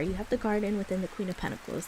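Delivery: ordinary delivery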